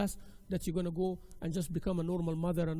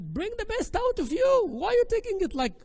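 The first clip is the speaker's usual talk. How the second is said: loud, high-pitched urging; forceful exhortation; 13 semitones higher, 6 dB louder than usual. Real, not acted. Teacher urging sisters facing criticism, forceful exhortation. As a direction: raise your voice and push them hard.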